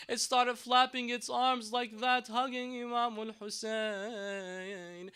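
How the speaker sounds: passionate mourning sermon. Acted. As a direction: preach the baby's death as a rising lament. Grief-laden preaching climax.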